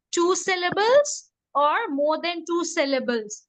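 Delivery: ordinary talk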